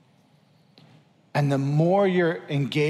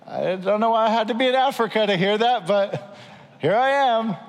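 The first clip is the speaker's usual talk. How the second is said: high, loud acted exclamation; playful, raised-voice answer; hammed-up shouted reply; 7 semitones higher, 4 dB louder than usual. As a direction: call it back up to God. Acting listener answering God's yell.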